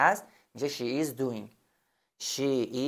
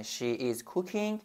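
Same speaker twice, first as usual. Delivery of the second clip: very slow, soft She is cooking dinner; slow quiet dictation; slow model sentence; 6 dB quieter, much slower than usual. Shown as a demonstration. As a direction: dictate gently word by word.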